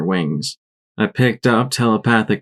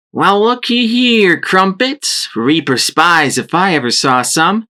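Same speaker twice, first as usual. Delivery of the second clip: amplified ranger mare; 9 semitones higher, slower than usual. A narrator's reading. Shrapnel calling to the family through her armor speakers. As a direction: call out like through a loudspeaker. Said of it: "voice amplified by the speakers of her power armor".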